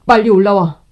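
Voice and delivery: loud angry order; angry command. Acted. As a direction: bark the order in fury. Husband orders his sister up.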